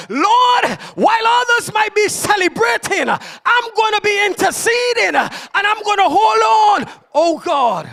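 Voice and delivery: loud, high, driving sermon cry; impassioned preacher lifting the church; fervent preaching. Acted. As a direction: preach it out at full force. Preaching at full force.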